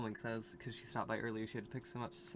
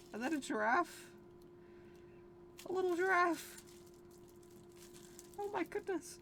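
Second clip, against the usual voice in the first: much higher, delighted cooing; gushing squeal; 4 dB quieter than usual. Real, not acted. Showing aunt's Easter gifts, gushing in a high squeal. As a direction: coo over each little gift.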